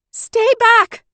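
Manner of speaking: high, loud warning call; urgent shouted warning; answering shout. A narrator's reading. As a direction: yell the warning up from below. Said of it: "came the answering call from somewhere low".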